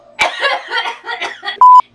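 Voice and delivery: coughing it out with a laugh; loud cough breaking into laughter. Real, not acted. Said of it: "think I just swallowed a bug".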